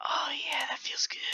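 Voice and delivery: unvoiced, satisfied whisper. Real, not acted. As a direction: breathe it out with relish. Savoring the win.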